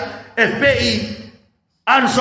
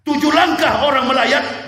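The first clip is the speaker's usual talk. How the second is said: ordinary talk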